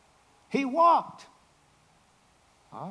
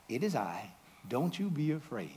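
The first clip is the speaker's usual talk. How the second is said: soft reassurance; 5 semitones lower, 7 dB quieter than usual. Acted. Repeats Jesus' words gently.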